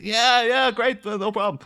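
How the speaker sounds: high, strained agreement; put-on eager acceptance; forced enthusiasm. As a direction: agree too eagerly, pitch pushed up. Kind reluctantly accepting the gift painting.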